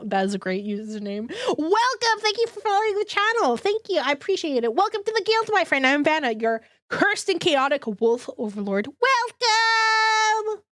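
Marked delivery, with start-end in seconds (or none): 1.5-10.6 higher sing-song greeting voice; cheery welcome. Acted. Streamer greeting a new follower with a bright welcome.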